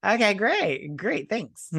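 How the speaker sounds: high, sarcastic okay great; mock thanks to a boring book. Reader bored by war manuals, sarcastic high thanks.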